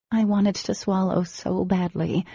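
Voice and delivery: normal talking voice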